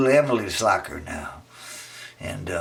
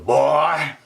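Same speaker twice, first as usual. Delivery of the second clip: put-on principal's boy; 4 semitones higher, 4 dB louder than usual. Principal voiced.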